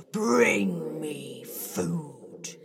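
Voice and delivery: very slow, drawn-out growled demand; slow monster demand. Acted. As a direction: drag out the order like a monster.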